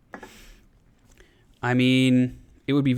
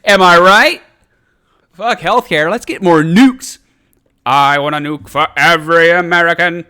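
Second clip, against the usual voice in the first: hammed-up rally shout; 4 semitones higher, 14 dB louder than usual. Mock politician rallying for nukes.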